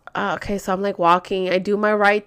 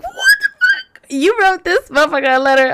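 high, louder mock outburst; retold outraged disbelief; 10 semitones higher, 5 dB louder than usual. Daughter reacting to mom writing the boyfriend, high outraged disbelief. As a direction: act out being appalled, voice jumping up. Retold shock at mom's letter.

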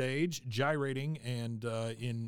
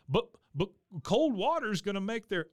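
higher, flustered Hank voice; a worried man sputtering it out; voicing Hank; 8 semitones higher than usual. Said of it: "and Hank says".

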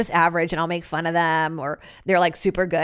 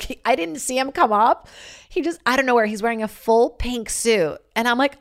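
ordinary delivery

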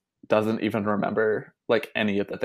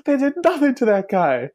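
much higher, loud mock outrage; mock outraged manager; 4 dB louder than usual. Manager ranting about the other team, mock outrage. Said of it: "He's like".

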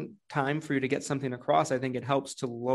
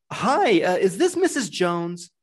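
higher, louder, bright sales opener; excited phone greeting; 5 semitones higher, 7 dB louder, slower than usual. Shown as a demonstration. Salesman opening a call to Missus Jones, excited. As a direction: greet her like you're thrilled to call. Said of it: "just a little bit of an excitement, enthusiasm there".

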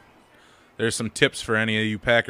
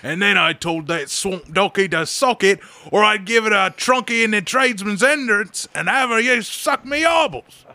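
loud, high British rant; hammed-up British lout, stretching the last words; British hooligan; 10 semitones higher, 9 dB louder than usual. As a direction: roll out slang in a shouted British accent. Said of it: "he's like".

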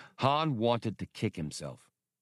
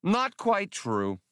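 slow, higher, grudging admission; slow, higher-pitched reluctant admission; 12 semitones higher, 3 dB louder, much slower than usual. Acted. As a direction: draw it out, sheepishly conceding. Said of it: "the territorial manager admitted".

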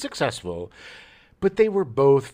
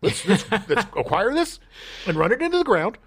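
greedy studio exec; 6 semitones higher than usual. Retold or imitated. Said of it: "the studios are like".

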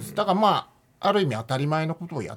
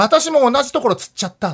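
higher, louder mock-granny complaint; lightly voiced granny; 8 semitones higher, 5 dB louder than usual. Granny on the hike reporting her cramp, lightly imitated in a raised voice. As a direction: pipe up like an old lady chiming in. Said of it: "全然違う参加者のおばあちゃんが".

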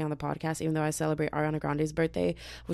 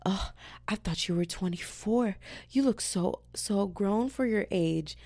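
lightly mimicked flirt, stretched slowly on the last words; flirty guys imitated; 4 semitones higher, slower than usual. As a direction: coo a cheesy compliment, dragging out so grown. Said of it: "I was getting hit on all the time".

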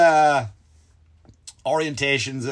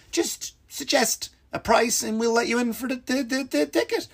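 higher mock clerk voice; 9 semitones higher than usual. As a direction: play the ticket clerk, pitched up. Voicing the ticket clerk.

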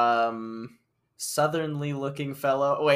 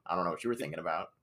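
sheepish backpedal; 7 semitones lower, 9 dB quieter than usual. Real, not acted.

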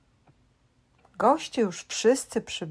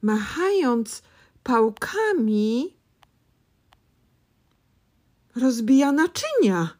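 brighter, louder storytelling; excited; 3 semitones higher, 5 dB louder than usual. A narrator's reading. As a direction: tell it with rising excitement. Excited narration of fly chaos.

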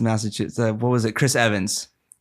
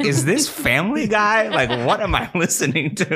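incredulous; 7 semitones higher than usual. Retold or imitated. Mock incredulous reaction.

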